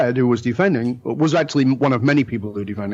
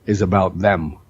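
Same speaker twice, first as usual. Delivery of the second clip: put-on Indian accent; 5 semitones lower, slower than usual. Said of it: "That's why I do the accent".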